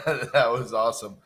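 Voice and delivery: laughing as the words start; chuckle, then say it with relish; real amused chuckle. Real, not acted. Amused after the call ends.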